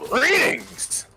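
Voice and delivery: grand greeting. Pretend.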